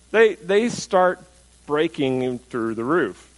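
very slow, drawn out for suspense; slow dramatic build. Acted. Preacher dramatizing friends tearing the roof, slow suspense.

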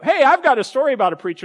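hammed-up keen seatmate; eager stranger. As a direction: burst in with a story to tell. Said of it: "or they go".

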